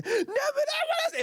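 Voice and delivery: put-on high whiny child protest; whiny kid. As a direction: whine like a kid who wants to stay up. Nephew crying to stay up.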